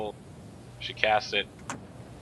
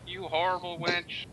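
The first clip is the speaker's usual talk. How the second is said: mock screaming; 11 semitones higher, 6 dB louder than usual. Game master voices Betzalel cursing the vanished villainess, hammed-up scream. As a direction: holler it high and loud, in character. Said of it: "You probably hear Betzalel screaming".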